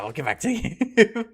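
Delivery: fast, breaking into a laugh on the last word; can't finish for giggling. Real, not acted. Repeating while giggling.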